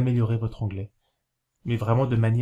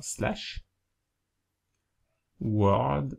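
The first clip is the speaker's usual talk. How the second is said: slow dictation; 3 dB quieter than usual. Shown as a demonstration. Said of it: "je vais essayer de bien écrire pour que vous puissiez le recopier".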